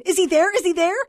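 high, loud, frantic repeated question; distraught wife crying out; desperate. A narrator's reading. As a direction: beg for an answer in panic. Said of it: "the voice asked in desperation".

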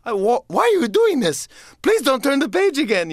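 Grover voice. Retold or imitated. Grover pleads with the reader while building a wall, high and panicked. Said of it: "And he's like".

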